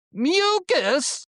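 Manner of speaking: shrill, loud MU-cus with the first syllable hammered; high-pitched shouted taunt. Retold or imitated. Kid taunting a classmate across the hall, high mocking shout. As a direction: yell "Mucus!" up high, hitting MU hard. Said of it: "I was shouting this across the hall".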